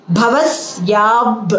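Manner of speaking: Bhavasyab slowly, lingering on the s; held s. Shown as a demonstration. Teacher showing students the hold, Bhavasyab with long s. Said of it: "You hold the is".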